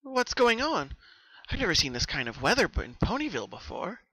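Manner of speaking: high, startled question; puzzled at the sudden fog; confused. Acted. Pinkie confused by the fog over Ponyville, starting high. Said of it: "Pinkie asked, confused".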